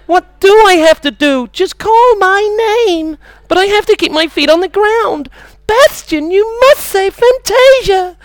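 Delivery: high, drawn-out cry of desperation; film melodrama. Acted. Comic acts out Never-Ending Story scene, high melodrama.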